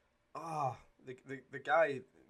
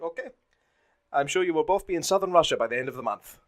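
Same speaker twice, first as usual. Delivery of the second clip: smug Nazi officer, loud and higher; a mock-polite German officer dismissing them; officer brushing them off, smug Nazi voice; generic Nazi voice; 5 semitones higher, 11 dB louder than usual. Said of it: "my generic Nazi voice".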